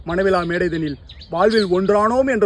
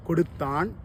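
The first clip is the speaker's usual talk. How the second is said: ordinary delivery, nothing marked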